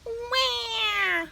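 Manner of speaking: high mock wail; bird crying wah. A narrator's reading. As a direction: wail like a tiny chick. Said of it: "began to cry".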